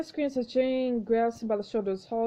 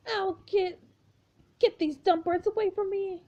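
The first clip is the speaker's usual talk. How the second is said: high whiny yelp; 9 semitones higher than usual. Acted. Lily pecked by birds.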